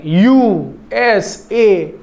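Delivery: "यू एस ए" as three slow, separate letters. Shown as a demonstration. Teacher showing students how an abbreviation is read, letter by letter. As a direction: pronounce each letter of "यू एस ए" individually. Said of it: "individually pronounce करते हैं".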